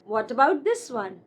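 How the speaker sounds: ordinary delivery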